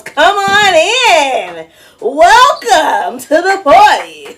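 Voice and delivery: loud, slow, high emcee welcome; sing-song loud greeting; showy welcome. Real, not acted. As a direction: stretch it out like a party host. Host welcoming latecomers in.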